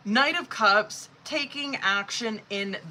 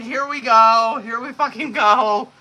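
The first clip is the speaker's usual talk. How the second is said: excited; 3 semitones higher, 10 dB louder than usual. Real, not acted. Excited rant about expected cards.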